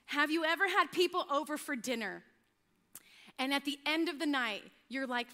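ordinary talk, nothing marked

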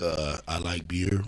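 low, quiet, slow mumbled answer; mock self-introduction. Guest quoting his answer to who are you, low and halting. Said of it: "I'll be like".

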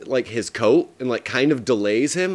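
ordinary delivery

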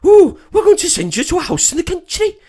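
high, loud put-on Welsh that drifts Scottish; hammed-up Welsh-turned-Scottish; attempted Welsh accent. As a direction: ham up a shrieking Welsh woman. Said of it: "Go on, do the accent".

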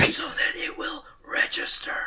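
forceful stage whisper; aggressive whisper. Pretend. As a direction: push the whisper hard into the mic. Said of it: "so aggressive with your whispering".

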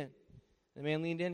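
ordinary talk, nothing marked

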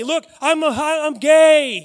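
loud, high public announcement; yelled confession. Pretend. Man forced to announce himself around town, yelling. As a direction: shout it out for everybody. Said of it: "That's what I yelled".

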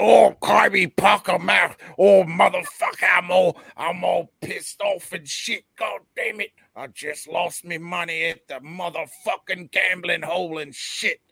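put-on high, loud drunken British lout ranting; hammed-up British accent yelling at his dog; angry British bloke. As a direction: play a belligerent working-class Brit. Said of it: "one of those blokes".

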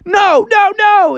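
high, loud cartoon panic; panicked Froggy. Froggy protests being banished, high panicked cry. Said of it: "And Froggy was like".

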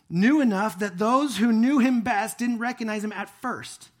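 loud, ringing preacher's voice; fervent preaching. Acted. Pastor proclaiming the resurrection body to his congregation, full force. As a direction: preach it out to the whole room.

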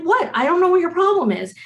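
high, exasperated protest to a bird; baffled protest. Retold or imitated. She answers the yelling robin, baffled and high. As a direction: throw up your hands at a screaming bird.

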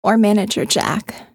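plain reading voice

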